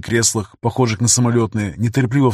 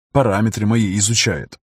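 plain reading voice

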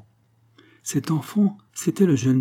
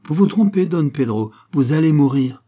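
loud, higher-pitched scornful verdict; disdainful; 5 semitones higher, 6 dB louder than usual. A narrator's reading. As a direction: pronounce his doom with open disdain. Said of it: "eut un dédaigneux sourire et continua".

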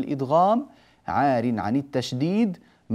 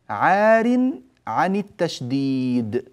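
slow repeat; 3 semitones higher, much slower than usual. Shown as a demonstration. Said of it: "ما معنى عار عن التشديد".

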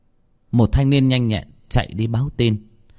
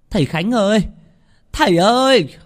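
high, louder call from the gate; 12 semitones higher, 4 dB louder than usual. Acted. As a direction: call for the master at his gate. Gọi thầy ngoài cổng. (gọi to thầy Khánh ngoài cổng)

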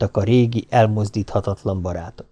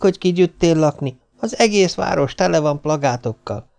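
put-on a higher, eager boy bursting with news; a street kid bragging about the show; lightly voiced excited boy; 6 semitones higher than usual. A narrator's reading. Narrator voices Alter excited.

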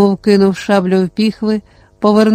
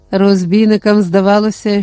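plain reading voice

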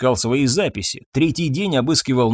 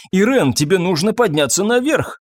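urgent husband; 7 semitones higher than usual. A narrator's reading. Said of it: "Он не мог отдышаться".

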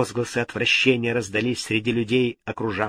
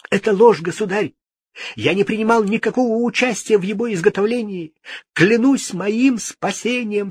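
much higher, louder, panicked; frightened cry; 11 semitones higher, 5 dB louder than usual. A narrator's reading. Frightened astrologer denies guilt to the king. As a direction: swear for your life in terror. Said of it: "вскричал испуганный астролог".

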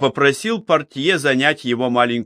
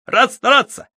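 high, loud cheerful soldier's bark; loud gung-ho soldier; 13 semitones higher, 4 dB louder than usual. Acted. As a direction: snap it out like an eager private. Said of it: "Юноша весело щёлкнул каблуками".

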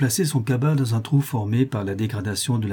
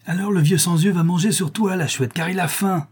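higher, sudden taunting cry; pitched-up, eager outburst; exclaiming; 8 semitones higher than usual. A narrator's reading. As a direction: call it out in mock glee. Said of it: "s'écria Tortillard".

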